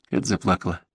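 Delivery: ordinary delivery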